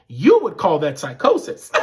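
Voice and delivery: ordinary talk, nothing marked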